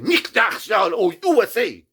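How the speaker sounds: high, loud put-on German voice; mock German seller. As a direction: parody the stern seller.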